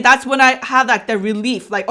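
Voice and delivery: usual speaking voice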